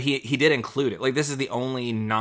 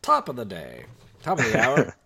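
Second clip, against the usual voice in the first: morning radio host; 4 dB louder than usual. Pretend. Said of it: "like a morning radio host".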